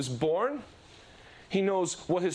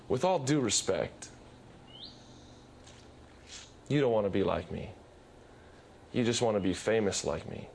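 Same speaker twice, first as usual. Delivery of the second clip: gentle Jesus; 8 semitones lower than usual. Retold or imitated. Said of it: "Would Jesus come to you and say".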